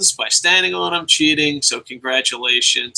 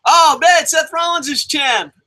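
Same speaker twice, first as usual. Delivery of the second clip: much higher, louder fake excitement; mock delighted fan; 13 semitones higher, 4 dB louder than usual. Fan cheering the new champ, hammed-up high delight. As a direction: gush like a fan who got his wish. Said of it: "mock excitement".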